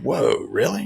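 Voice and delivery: high, loud amazed whoa, really; surprised whoa. Retold or imitated. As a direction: replay your own jaw-drop at the son's answer.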